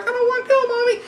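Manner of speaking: put-on high whiny child's plea; creepy kid voice. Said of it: "followed by this kid like".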